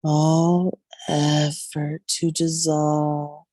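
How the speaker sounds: slow, drawn-out meditation voice with long pauses; guided Yoga Nidra meditation. Real, not acted. Yoga guide leading listeners into deep rest, slow meditative delivery. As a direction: ease them into rest, stretching the words slowly.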